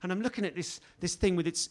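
normal talking voice

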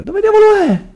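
strillo acutissimo e forte; allarme sorpreso. Real, not acted. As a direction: chiedilo gridando, colto alla sprovvista. Cerca allarmato nel gioco. (domanda strillata, allarmata)

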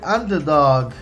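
normal talking voice

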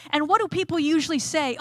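ordinary talk, nothing marked

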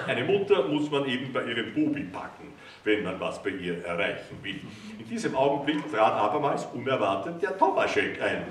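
ordinary delivery, nothing marked

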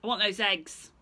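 loud, menacing bear voice; growly bear. Acted. Bear demands eggs from Stephen, loud and menacing. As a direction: threaten like a big bear.

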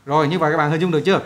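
ordinary talk

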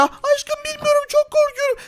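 very high, soft, slow and timid; scared spider girl. Acted. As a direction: whimper out your fear in a tiny voice. (çok ince ve yumuşak sesle korkan örümcek kız)

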